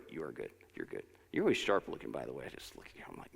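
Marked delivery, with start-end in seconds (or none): 0.1-0.5 "You are good" dropped low and much softer; soft, low affirmation. Shown as a demonstration. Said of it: "Practice that". 0.7-1.0 much lower and softer, almost to himself; quiet affirmation. Shown as a demonstration. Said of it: "Practice that".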